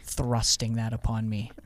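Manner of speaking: ordinary delivery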